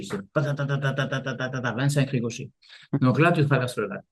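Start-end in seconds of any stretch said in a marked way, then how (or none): 0.3-1.7 rapid string of light, soft taps; skipping-stone sound. Shown as a demonstration. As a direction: imitate a stone skipping across water, softly. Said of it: "c'est des ricochets".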